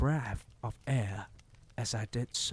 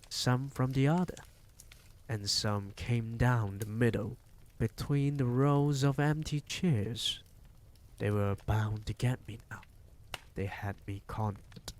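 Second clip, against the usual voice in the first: ordinary delivery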